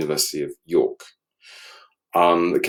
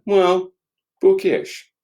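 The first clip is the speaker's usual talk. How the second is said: "bookish" said much higher in a lightly put-on voice; mock bookish man; 13 semitones higher, 5 dB louder, much slower than usual. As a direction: play a prissy bookish gent, pitched up.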